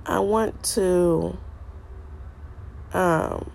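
usual speaking voice

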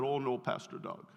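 put-on low, deep voice in a thick African accent. Visiting pastor from Sierra Leone refusing his prayers, deep African accent. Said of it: "really deep voice and this thick African accent".

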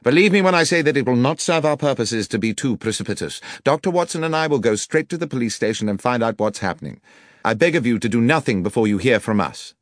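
urgent detective. Acted. Detective begging the landlord to wait, urgent and firm. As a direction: talk him down fast and firmly.

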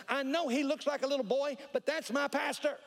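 fierce, very high-pitched mock demand; Clois. Clois confronts nurse, defending her pastor.

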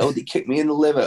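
high, fast mock whine; whiny wrestler excuse. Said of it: "It's like".

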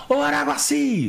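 very high stadium holler; imitated shout. The man in the stands hailing him, imitated high shout. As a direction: call insistently to someone far off. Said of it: "Aí o cara gritou de novo".

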